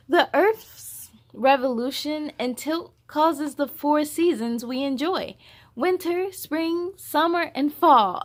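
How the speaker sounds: put-on reading to children. Host reading the picture book aloud to young viewers. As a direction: read it out big and lively for little ones.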